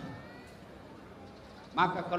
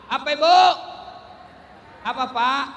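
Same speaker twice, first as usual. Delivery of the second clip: loud call-out; 2 semitones higher, 8 dB louder than usual. Real, not acted. Calling on the congregation.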